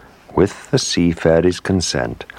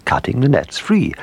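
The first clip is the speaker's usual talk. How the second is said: high-pitched, imperious royal order; impatient queen; 11 semitones higher than usual. A narrator's reading. Queen orders messenger to send swordfish to the whale, impatiently. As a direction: give the order as a queen losing patience. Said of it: "said the Queen impatiently".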